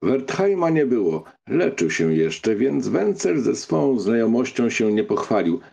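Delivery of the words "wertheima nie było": plain reading voice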